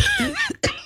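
choking cough-laugh; sputtering, choking laugh; choking on a drink. Real, not acted. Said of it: "Sorry, I'm choking".

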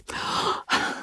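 soft acted gasp; sharp intake of breath at the news. Listener reacts to the letter's news.

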